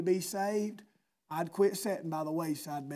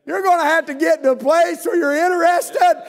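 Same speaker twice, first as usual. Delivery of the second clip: loud, high, fervent sermon delivery; preaching at full force; 11 semitones higher, 14 dB louder than usual. Acted. Preacher exhorting his congregation, shouting fervently. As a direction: press the point hard on the congregation.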